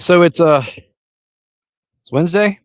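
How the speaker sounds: usual speaking voice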